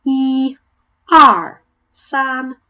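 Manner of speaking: "E, R, san" said clearly one by one, "R" loud; count in Chinese. Shown as a demonstration. Teacher leading the class drill, "R" loud. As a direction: lead the drill, each number on its own. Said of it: "I'll say them and then you say them".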